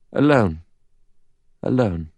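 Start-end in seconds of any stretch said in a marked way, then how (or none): 1.6-2.1 faint echo off rocks. Acted. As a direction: let it come back like an echo. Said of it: "the echo of her voice came back".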